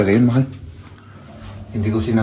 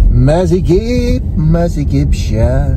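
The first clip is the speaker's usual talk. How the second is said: drawn-out echo; 6 semitones higher, 4 dB louder, much slower than usual. Pretend. Echoing wife's complaint, drawn out.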